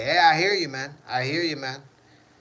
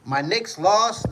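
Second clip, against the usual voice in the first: "My Knicks lost" said slowly, higher, each word drawn out; slow lament; 5 semitones higher, 4 dB louder, slower than usual. Real, not acted. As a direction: drag out "My Knicks lost" in dismay.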